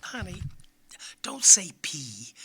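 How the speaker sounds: hushed, slow mom voice; mother. Acted. Mother hushing her little boy at the doctor, whispering. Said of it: "And mother goes".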